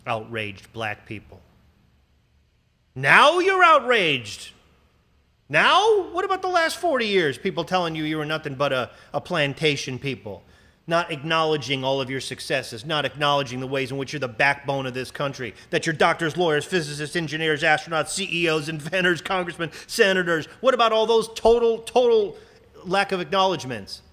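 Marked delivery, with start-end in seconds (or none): 2.9-7.4 much higher, louder, drawn-out indignation; indignant outrage. Real, not acted. Ranting host scolding the late outrage, loud and slow. 20.6-24.0 louder, higher demand; indignant. Real, not acted. Rant continues.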